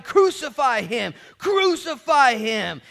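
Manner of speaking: slow, high, loud mob cry; drawn-out yelled demand; crowd shouting. A narrator's reading. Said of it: "but they shouted".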